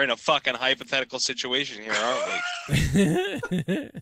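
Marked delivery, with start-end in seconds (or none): 1.9-3.9 laughing. Real, not acted. Laughing exclamation.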